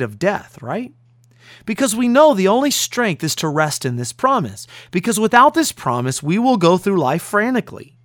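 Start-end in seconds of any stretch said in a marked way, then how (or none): none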